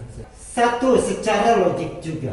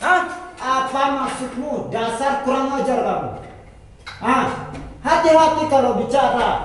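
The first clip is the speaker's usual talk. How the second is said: angry rebuke; 4 semitones higher than usual. Real, not acted. Guest scolds opponent.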